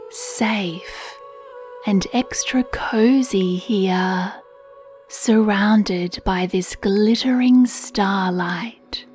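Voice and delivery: ordinary delivery, nothing marked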